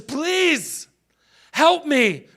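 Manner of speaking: high, drawn-out plea; acted pleading. Outside woman begging Jesus for help, pleading.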